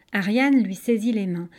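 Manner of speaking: ordinary delivery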